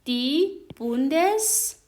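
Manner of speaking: دی بوندس رگیرونگ slow and clear; slow careful teaching read; slow demo. Shown as a demonstration.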